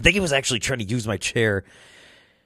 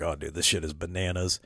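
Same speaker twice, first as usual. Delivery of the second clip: low, quiet retold disbelief; muted amazement; 4 semitones lower, 9 dB quieter than usual. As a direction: say it under your breath, impressed. Retelling his muted amazement.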